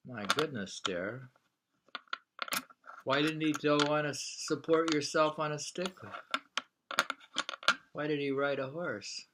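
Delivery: ordinary talk, nothing marked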